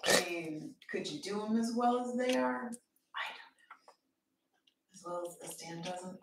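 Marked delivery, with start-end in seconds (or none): 3.1-6.2 whispering. Real, not acted. Musing to herself between questions.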